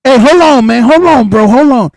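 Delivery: urgently cutting in on a friend; excited interruption. Real, not acted. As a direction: cut in, urgent, to stop the talk.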